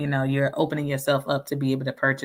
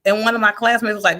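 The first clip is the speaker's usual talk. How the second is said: usual speaking voice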